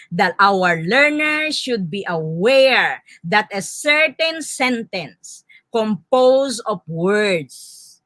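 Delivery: ordinary delivery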